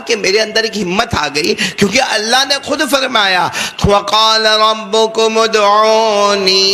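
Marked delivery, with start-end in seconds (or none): 3.8-6.7 slow, chanted Arabic recitation; تلاوت کے انداز میں آہستہ پڑھیں; Quranic recitation. Acted. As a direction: recite the verse slowly and melodically. Quoting the Quran.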